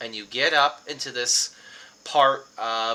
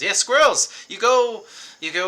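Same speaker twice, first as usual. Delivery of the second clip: squirrels defended; 6 semitones higher, 7 dB louder than usual. Acted. Reginald defends squirrel hunting.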